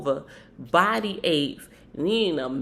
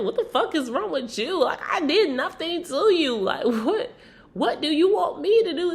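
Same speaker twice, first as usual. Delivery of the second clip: imitating Natalie; 8 semitones higher than usual. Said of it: "So Natalie's like".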